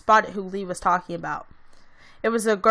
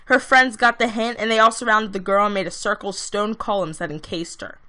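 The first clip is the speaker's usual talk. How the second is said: usual speaking voice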